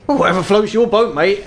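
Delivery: high, bemused elf voice; confused elf shopkeeper. Acted. Elf shopkeeper accepts the customer's odd date, high and confused. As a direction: pitch it up, puzzled but accepting. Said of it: "with a confused look on his face, he goes".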